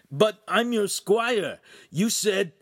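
high, loud, wounded protest; pleading. A narrator's reading. Rejected squire appealing to his master, high pleading.

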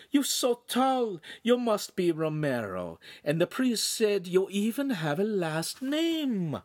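high, drawn-out Italian hostess voice; fussing lady of the house; gushing signora. A narrator's reading. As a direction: welcome them effusively in a high, slow voice. Signora greets the newcomers.

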